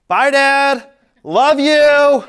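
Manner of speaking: loud, high yell imitating his daughter; imitated yelling daughter. Daughter yells goodbye to dad at school drop-off, imitated high yell. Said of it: "Why are you yelling at me".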